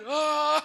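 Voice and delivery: high, loud gasp for air; desperate gasp. Retold or imitated. Breathless driver on a long road, desperate gasp. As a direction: pull the breath in hard.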